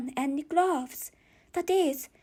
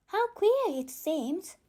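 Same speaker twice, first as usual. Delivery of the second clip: put-on lightly raised, slow girlish musing; Alice's voice; 6 semitones higher than usual. Alice muses to herself, lightly voiced girl. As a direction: puzzle over it like a small girl.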